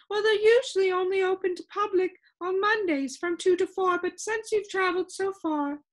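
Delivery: a fussy, lilting lady with static in her; complaining sound keeper. Acted. Sound Keeper answering the visitor, high and complaining.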